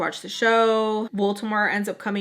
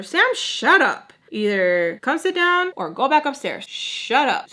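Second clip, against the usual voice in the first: put-on louder, higher exasperated mom telling off her kid; scolding; 5 semitones higher, 5 dB louder than usual. Mom ordering her noisy daughter to behave, scolding. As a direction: imitate a mother laying down the law.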